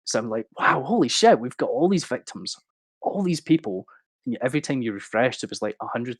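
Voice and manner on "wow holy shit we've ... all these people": higher and louder, a retold "wow, holy shit"; excited disbelief. Retelling his discovery of the fraud file to the host, excited. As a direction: replay the shock of finding the file.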